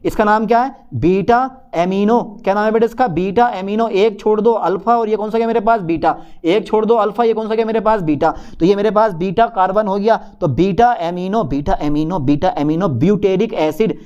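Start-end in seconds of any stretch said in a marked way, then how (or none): none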